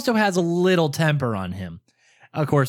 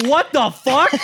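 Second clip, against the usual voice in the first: high, loud, incredulous question; baffled disbelief; 8 semitones higher, 5 dB louder, slower than usual. Retold or imitated. Caller reacting to brother's outburst, baffled disbelief. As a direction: react in amused disbelief, retold.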